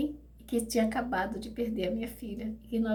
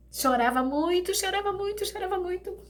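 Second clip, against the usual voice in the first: higher, breaking repetition; pitched-up grieving refrain; emotional repetition; 9 semitones higher than usual. Retold or imitated. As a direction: voice rising with grief. Recalling crying hard.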